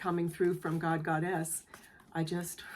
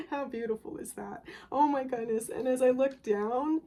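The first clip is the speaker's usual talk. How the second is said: high, laughing amazement; overjoyed awe; 7 semitones higher, 4 dB louder than usual. Real, not acted. Reader amazed by a beautiful heart charm, overjoyed awe. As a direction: marvel with pitch lifted, building louder.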